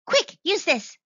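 high, loud, urgent prompt. A narrator's reading. Mouse handing her a bluebell flower, urgent. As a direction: urge a friend to act fast.

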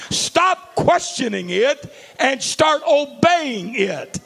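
high, loud and slow command; preaching shout. Acted. Preacher ordering the congregation to obey, slow loud shout.

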